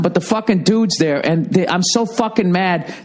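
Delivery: ordinary delivery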